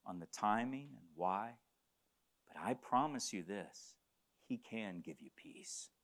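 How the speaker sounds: soft, low voice with long pauses; gentle pastoral comfort. Acted. Preacher offering a word of comfort and peace, gently hushed. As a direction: speak softly to console the grieving.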